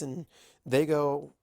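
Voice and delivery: ordinary delivery, nothing marked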